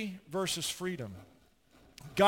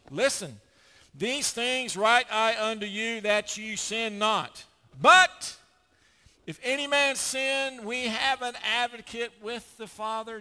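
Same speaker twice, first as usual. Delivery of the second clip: slow, emphatic reading of the verse; scripture reading; 3 semitones higher, 4 dB louder, slower than usual. Acted. Preacher reading First John to the congregation, slow and emphatic. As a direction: read it out slowly with weight.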